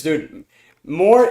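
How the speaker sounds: ordinary talk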